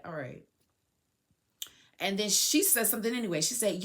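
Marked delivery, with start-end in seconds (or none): 0.0-0.4 low, very soft reply; small, deflated retold answer; subdued acceptance. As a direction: give in quietly, dropping right down. Her subdued answer to the shout.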